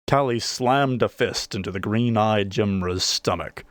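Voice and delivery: ordinary delivery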